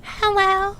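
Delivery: put-on very high, tiny anime girl "Hello"; cute anime girl voice. Shown as a demonstration. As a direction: squeak it out like an anime heroine. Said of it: "would you mind showing it to our dear audience".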